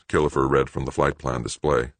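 plain reading voice

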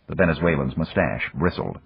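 ordinary delivery, nothing marked

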